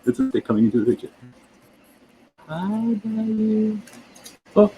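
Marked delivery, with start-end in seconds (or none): 2.5-3.8 high, drawn-out soft greeting; coaxing sing-song. Real, not acted. Host gingerly greeting a sleeping creature, coaxing sing-song. 4.5-4.7 high, loud startled exclamations; jumpy, raised voice in short bursts; real nervous alarm. Real, not acted. Host watching the creature start moving, nervous alarm.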